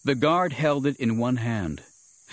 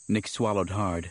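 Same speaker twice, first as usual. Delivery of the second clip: usual speaking voice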